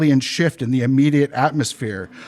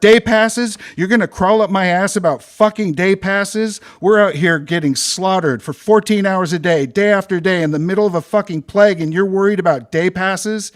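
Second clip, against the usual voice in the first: furious rant; 4 semitones higher than usual. Acted. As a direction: tear into the supervisor. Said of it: "I barked".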